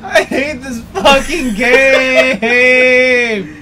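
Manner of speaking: slow, drawn-out laughing rage; raging gamer. Real, not acted. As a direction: stretch it out in laughing fury. Rage at game.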